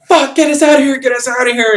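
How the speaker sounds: high, loud acted panic; panicked yell. Villain on the line demanding escape, in a panicked yell. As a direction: imitate a frantic order to evacuate.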